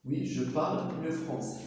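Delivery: very slow "Oui, je parle le français."; slow answer. Shown as a demonstration. Teacher modelling the full answer slowly for students. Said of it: "ونكمل لا فراس".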